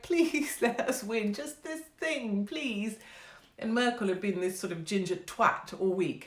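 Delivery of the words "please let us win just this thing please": high, slow, drawn-out begging; hammed-up pleading. All the fans begging for a win, high mock-desperate pleading. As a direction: beg theatrically, stretching each plea. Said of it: "they were like".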